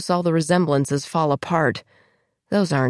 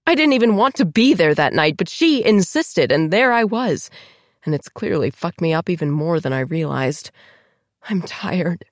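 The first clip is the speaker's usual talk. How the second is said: usual speaking voice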